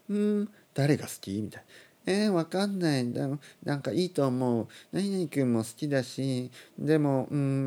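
high-pitched, dithering mock youngster; mumbling indecision. Imitating Terrace House cast unsure whom they like, mumbly dithering. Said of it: "もごもごしてますね".

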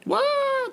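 high mock shriek; hammed-up falsetto scream; panicked "waah" scream. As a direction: cry out in playful panic, way up high. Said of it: "そういう声を出します".

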